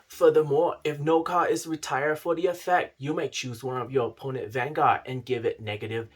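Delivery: put-on broad Australian accent, hammed up; fake Australian accent. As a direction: play a mock Aussie card-show host. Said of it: "the correct Australian accent".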